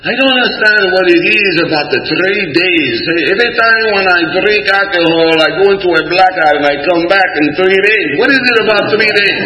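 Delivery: high-pitched, exasperated complaint; puzzled member venting at a meeting; bewildered drinker. Acted. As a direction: plead for an explanation in a high, baffled voice. Quoting baffled guy at meeting.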